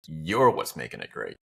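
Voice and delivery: usual speaking voice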